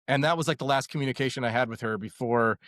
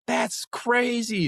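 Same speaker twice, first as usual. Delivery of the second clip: high, long drawn-out mock holler; stretched-out, high-pitched retold yell; imitated excited yelling; 10 semitones higher, much slower than usual. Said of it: "she was, like, yelling and screaming".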